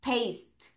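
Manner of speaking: PASTE modelled softly with a long A sound and silent E; soft teacher's model of "paste", the E left silent; pronunciation demo. Shown as a demonstration. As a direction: say "paste" for students to copy, long A, falling tune. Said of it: "다시 따라하세요".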